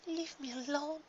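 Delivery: high, soft half-asleep mumble; drowsy mumble. Acted. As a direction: mumble it barely awake. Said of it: "she mumbled".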